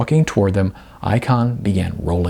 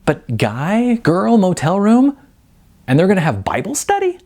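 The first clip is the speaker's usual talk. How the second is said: incredulous; 10 semitones higher than usual. Acted. Jasmine incredulous, higher voice.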